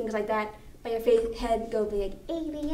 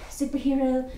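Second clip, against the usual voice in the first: normal talking voice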